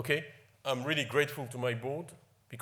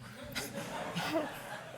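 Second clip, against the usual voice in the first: high breathy laugh; imitating a scornful laugh; mock laugh; 4 dB quieter than usual. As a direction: laugh it off like the principal did. Retelling principal's laugh.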